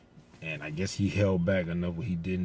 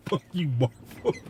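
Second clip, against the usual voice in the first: slow, higher, amused curse; mock curse at Marvel; 8 semitones higher, 4 dB louder than usual. Moviegoer cursing Marvel over the cliffhanger, slow amused jab. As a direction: drag it out, teasing the studio.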